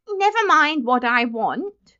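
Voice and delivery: put-on high, loud, slow, indignant boy; angry retort. A narrator's reading. Benjamin retorting to the clerk, angrily. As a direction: snap back, dragging out the words. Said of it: "he retorted angrily".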